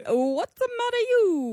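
high, sing-song mock Italian; hammed-up Italian accent. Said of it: "You should also do this".

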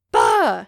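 loud, explosive bah; cry of revulsion. Real, not acted. Podcaster shuddering at a grocery store memory, loud cry of revulsion. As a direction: blurt out a big shudder of horror.

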